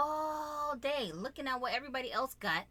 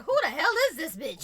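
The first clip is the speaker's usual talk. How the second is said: mock outrage; 3 semitones higher, 8 dB louder than usual. Retelling partner's reaction.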